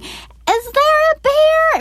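put-on high, slow little child asking; toddler voice. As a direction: play the curious toddler. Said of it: "who's looking around going".

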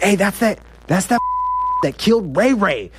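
hammed-up cry of recognition; retold accusing yell. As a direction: imitate inmates pointing out a culprit. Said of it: "People are just yelling and screaming".

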